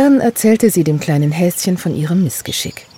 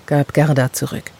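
ordinary delivery

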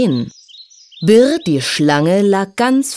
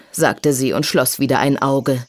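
ordinary delivery

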